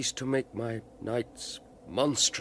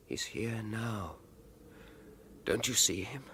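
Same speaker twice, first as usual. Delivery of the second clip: awed hush; 9 dB quieter than usual. Acted. Alfred sees the ghost.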